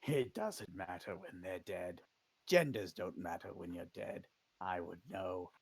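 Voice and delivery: low, hushed lich voice; quiet, deep-pitched lich aside; dry undead lich. Acted. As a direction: speak low and soft as the undead. Lich speaks in game.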